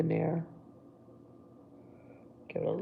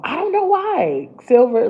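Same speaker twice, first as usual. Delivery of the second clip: much louder, animated rant with a laugh; excited; 11 semitones higher, 12 dB louder than usual. Real, not acted. Telling viewers about her silver obsession, loud excitement. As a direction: gush about silver with rising energy.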